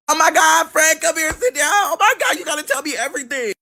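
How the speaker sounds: high, breathless excited gushing; gushing classmate. Retold or imitated. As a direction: beg for the gossip in a squeal. Classmate begging for gossip.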